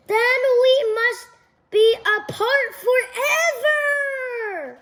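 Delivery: high, crying and slowing on forever; tearful. Acted. Gerald telling Piggie they must part, crying. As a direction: wail it like a heartbroken goodbye.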